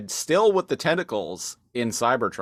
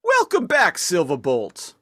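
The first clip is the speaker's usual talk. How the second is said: hammed-up hero greeting; 8 semitones higher, 7 dB louder than usual. Co-host voices a character line.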